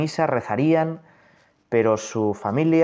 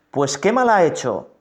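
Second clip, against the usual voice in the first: higher, louder pleading question; Pilate protesting; 6 semitones higher, 5 dB louder than usual. Acted. Pilate protests to the shouting crowd, raised. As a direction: push back as Pilate, raised.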